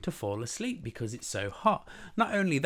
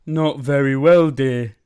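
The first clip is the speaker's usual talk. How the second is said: loud, yawned-out reply; yawning; 3 semitones higher, 12 dB louder, slower than usual. Acted. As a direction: yawn through the words, then sigh. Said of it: "yawned Mister Bear".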